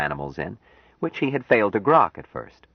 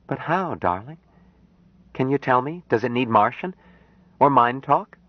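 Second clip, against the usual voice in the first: put-on higher young woman's voice, eager questions; lightly voiced Jill, puzzled and eager; Jill, curious; 6 semitones higher than usual. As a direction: press him gently for an answer.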